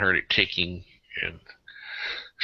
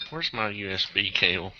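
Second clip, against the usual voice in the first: ordinary delivery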